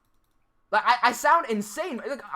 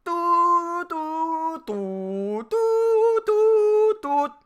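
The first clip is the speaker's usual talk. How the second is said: mimicked electronic beeps, jumping much higher on the last ones; imitated alarm beep; 8 semitones higher, 4 dB louder, much slower than usual. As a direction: copy a strange alarm tone, doo by doo. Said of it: "I just hear, like".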